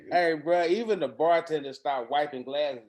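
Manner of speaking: ordinary talk, nothing marked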